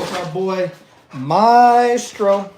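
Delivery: ordinary delivery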